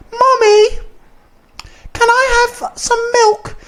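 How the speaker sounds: put-on extremely high, squeaky child voice; hammed-up falsetto toddler, slow and drawn-out; mock little kid. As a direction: squeal it like a tiny kid begging. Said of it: "like, real, like".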